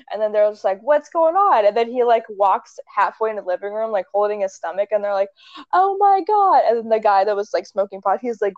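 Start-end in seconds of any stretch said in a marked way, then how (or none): 0.8-1.6 higher-pitched acted bewilderment; mock alarm. Acting out friends seeing a stabbing. 5.7-6.6 high-pitched acted gasp of shock; mock horror. Acting out friends seeing a stabbing.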